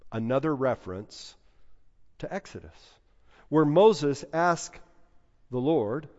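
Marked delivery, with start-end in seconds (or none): none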